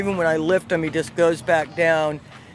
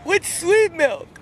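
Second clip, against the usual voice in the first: much higher and slow, puzzled; 10 semitones higher, slower than usual. Retold or imitated. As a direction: ask in total confusion. Her puzzled question retold.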